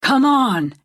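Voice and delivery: impatient call; shout. A narrator's reading. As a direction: shout it over road noise. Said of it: "he shouts".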